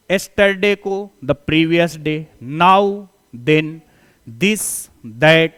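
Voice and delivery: drawn-out, deliberate dictation; slow dictation for notes. Shown as a demonstration. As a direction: let the class write every word. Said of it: "जैसे today".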